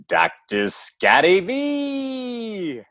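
high, drawn-out hype intro; announcer-style introduction. Real, not acted. Host hyping up the co-host, announcer-style introduction. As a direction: stretch the name out like a ring announcer.

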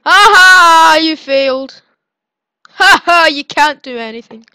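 loud mocking laugh then jeer; gloating taunt. Real, not acted. Player taunts the opponent who failed to eat him, loud gloating. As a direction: rub it in the loser's face.

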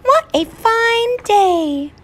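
high, bright chirping bird voice; cheerful chirpy bird. Acted. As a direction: chirp it like a happy little bird. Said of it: "chirped the bird gaily".

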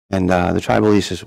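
ordinary talk, nothing marked

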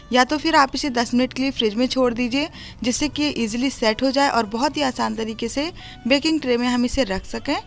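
ordinary talk

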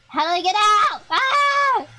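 panicked shout. Real, not acted. Stuck in the game, panicking.

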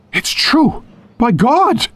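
high-pitched, amazed outburst; astonished exclamation. A narrator's reading. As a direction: exclaim it in shocked recognition. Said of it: "The revelation brought Harper to his feet".

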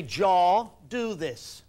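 JAW called out loud and slow; slow loud stress on Jaw; emphatic command. Retold or imitated. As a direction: bark the word Jaw slowly. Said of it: "She says".

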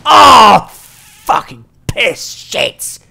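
loud, drawn-out angry curse; frustrated yell. Real, not acted. Game goes wrong.

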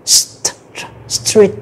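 St- first alone, then the full word street; cluster then word. Shown as a demonstration. Teacher breaking down street for students. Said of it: "âm S, âm tở và âm R".

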